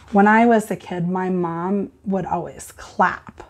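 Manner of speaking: ordinary talk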